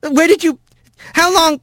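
high-pitched, bewildered stammering questions; high voice, too stunned to finish; flustered Rat. A narrator's reading. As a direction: stumble from one startled question to the next. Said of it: "he finally got out".

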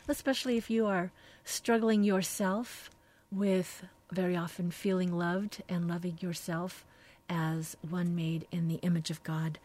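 usual speaking voice

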